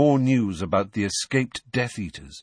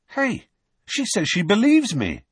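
surprised; 11 semitones higher, 3 dB louder than usual. Acted. Harry pleased surprise.

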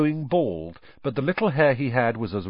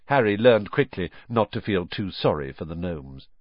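ordinary delivery, nothing marked

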